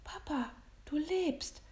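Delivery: high, slow gasp of relief. A narrator's reading. William finds his father alive, gasping. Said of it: "keuchte William".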